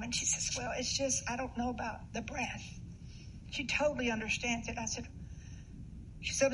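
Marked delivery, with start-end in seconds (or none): 0.6-2.6 soft quoted reply. Retold or imitated. Friend's reply, mother dying.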